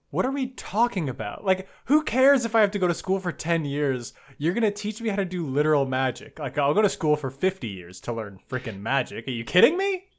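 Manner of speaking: exasperated, emphatic outburst; incredulous rant. Real, not acted. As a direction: rant as if it's absurdly obvious, pitch climbing at the end. Exasperated rant about learning magic.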